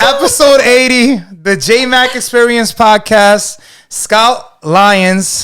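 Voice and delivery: slow announcer-style outro; podcast sign-off. Acted. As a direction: announce the episode slowly. Host signs off show.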